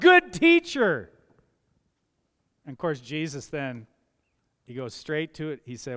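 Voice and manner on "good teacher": called out high and loud, slowly stretched; calling out. Acted. The young man calls out to Jesus, loud and eager. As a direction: hail him from across the crowd. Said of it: "And he calls out".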